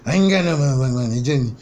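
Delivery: high, fast, loud whiny uncle rant; upset uncle impression. Vlogger imitating his uncle upset about having nowhere to go, high and fast. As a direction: spit it out fast like the broke uncle losing it. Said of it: "that's exactly how that motherfucker talk when he get upset".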